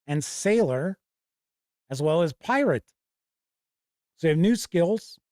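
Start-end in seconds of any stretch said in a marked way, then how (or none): none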